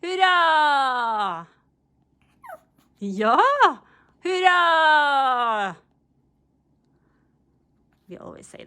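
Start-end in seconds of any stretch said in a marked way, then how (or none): 0.0-3.4 high loud drawn-out hurra; a big hurra for the dog; cheer. Real, not acted. 4.2-5.8 high loud drawn-out hurra; a big hurra for the dog; cheer. Real, not acted.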